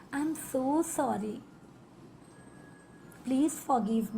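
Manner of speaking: very slow, lingering apology; deeply heartfelt. Shown as a demonstration. Guiding listeners to forgive themselves, slow and tender. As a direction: stretch the so in so sorry. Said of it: "दिल से कहेंगे".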